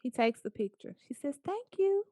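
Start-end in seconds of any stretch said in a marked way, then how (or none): 1.4-2.0 lady's voice. Retold or imitated. Lady voiced in story.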